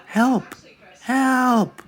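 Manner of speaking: high, far-off call for help; distant cry for help. A narrator's reading. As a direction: cry out as if from deep in the woods. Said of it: "sounded a deep voice".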